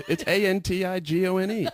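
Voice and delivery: high office-lady voice spelling aloud; imitating excited coworker. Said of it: "And she was like".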